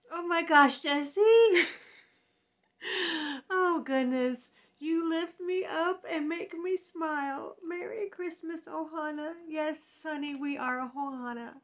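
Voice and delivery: real emotional cry while talking; ugly crying. Real, not acted. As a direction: choke up with happy tears. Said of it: "I'm ugly crying".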